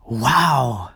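long, awed wow; amazed. A narrator's reading. Clemens marvels at Papa's victory, drawn-out awe. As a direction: let the admiration stretch out.

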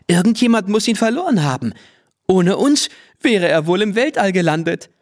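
hohe, schnelle Kinderstimme; Mädchenstimme. A narrator's reading. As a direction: sprudel es aufgeregt wie ein Kind. Lene spricht im Ballon-Moment. (hohe, eilige Mädchenstimme)

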